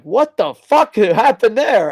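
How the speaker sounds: mock shocked yell; shocked outburst. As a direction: blurt it out in disbelief. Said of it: "I was like".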